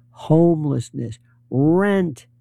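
ordinary talk

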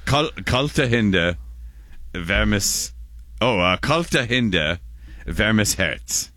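kalte Hände spoken slowly and carefully; slow German demo. Shown as a demonstration. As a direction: spell out kalte Hände word by word. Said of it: "I'm gesturing for, for listeners".

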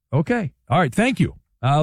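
ordinary talk, nothing marked

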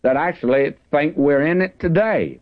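usual speaking voice

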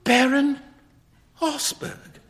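high-pitched gasp of disbelief, falling in volume; aghast, halting echo; shocked cry. A narrator's reading. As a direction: squeak the name, stunned. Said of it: "cried Hughie".

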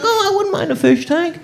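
high, put-on covetous musing; admiring visitor. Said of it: "You see, you're like".

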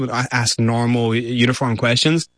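ordinary talk

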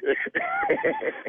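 a sharp, high burst of laughter. Real, not acted. Singer laughing off the prank call.

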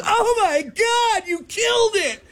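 very high, slow, shrieking woman; mock screaming old lady; woman's scream. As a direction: scream it in shock as the goose falls. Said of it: "The woman lets out a scream".

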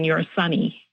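normal talking voice

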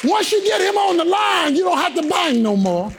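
preaching at full force. Acted. Preacher driving the point home to the church, full-force preaching. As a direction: preach it over the organ.

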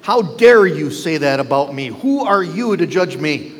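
ordinary delivery

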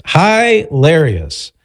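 hilarious as HIGH-larious, drawn out; playful mispronunciation. Shown as a demonstration. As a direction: stretch a high-pitched HIGH at the start of Hilarious.

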